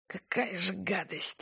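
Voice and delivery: very soft, slow disgusted wince; disgusted grimace. A narrator's reading. Rina wincing at Valentina's herbal brew, very soft and slow. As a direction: screw up your face at the taste. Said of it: "скривилась она".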